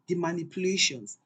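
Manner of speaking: plain reading voice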